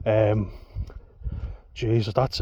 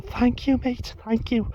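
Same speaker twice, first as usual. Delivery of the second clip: high-pitched mock Liverpool woman, gushing thanks; Scouse woman impression; 13 semitones higher, slower than usual. Missus thanking the narrator, high mock Scouse gush. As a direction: thank him in a bad high Scouse accent. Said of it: "a few bad accents in there".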